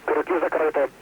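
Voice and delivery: astronaut radio. Real, not acted. Crew radio from space station.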